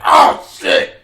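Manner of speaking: sudden loud low yelp of surprise; startled yell. Retold or imitated. Teacher falling backwards onto the ground, startled loud yell. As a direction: blurt it out as you hit the ground. Teacher falls on his back.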